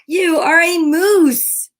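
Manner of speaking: high, loud, slow moose voice; Morris insisting. A narrator's reading. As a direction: lay it down slowly, sure of it. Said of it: "said Morris".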